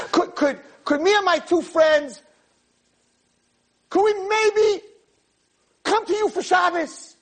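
very high, hammed-up anxious girl; imitation of a girl agonizing, long halting pauses; mock nervous. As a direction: squeak out the request in nervous bursts. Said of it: "what you're so nervous about".

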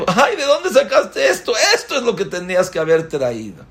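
high, delighted laughing cry; a wife laughing as she finds diamonds in his clothes; laughing surprise. Acted. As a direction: laugh in joyful disbelief at the find.

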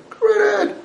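high mocking go right ahead; sarcastic. Stern father mocking the child who leaves, sarcastic. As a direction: toss it off with a sneer.

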